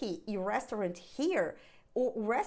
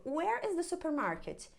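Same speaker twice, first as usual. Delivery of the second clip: asking directions; 5 semitones higher than usual. Shown as a demonstration. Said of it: "por exemplo".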